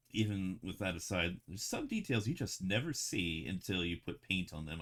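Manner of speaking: usual speaking voice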